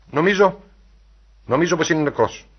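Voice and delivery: falter, then deliver terrible news; alarmed and halting; shocked alarm. A narrator's reading. Faltering news that friend is dead.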